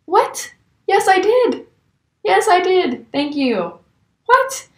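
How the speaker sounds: high, loud disbelieving yelps; mock-outraged shocked protest; incredulous outburst. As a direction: squeal it out like you can't believe your ears. Outraged at customer's accusation.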